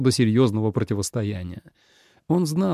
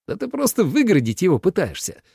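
higher-pitched accusing exclamation; astonished exclamation; 8 semitones higher than usual. A narrator's reading. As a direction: exclaim it in disbelief. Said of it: "после изумлённого молчания воскликнул Минлу". (изумлённо восклицая)

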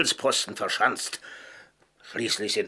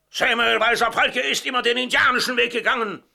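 high, loud, proud declaration; ringing, defiant pride; proud indignation; 12 semitones higher, 5 dB louder than usual. Acted. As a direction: proclaim it with wounded pride.

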